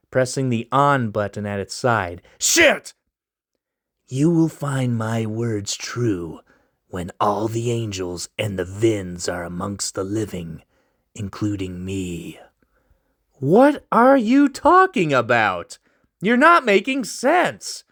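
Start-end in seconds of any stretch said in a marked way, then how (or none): 2.4-2.9 sudden high yelp of a curse; startled curse. Acted. Loris finding his phone dead, sudden high curse. 4.1-12.4 ominous stranger. Acted. Mysterious stranger voiced. 13.3-17.8 high, slow exasperated demand. Acted. Loris confronting the stranger, high exasperated demand.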